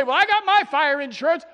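loud, high mock retort of a smug sinner; mocking the excuse. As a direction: toss off the excuse in a loud scoffing voice. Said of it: "you say".

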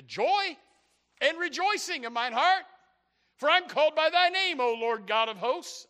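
high, loud scripture proclamation; full-force preaching. Real, not acted. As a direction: cry out the verse with rejoicing force.

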